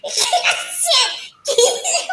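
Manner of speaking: shrieking high laughter; laughing hard. Real, not acted. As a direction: lose it completely, howling. Helpless laughing fit with friend.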